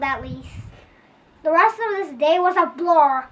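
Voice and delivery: plain reading voice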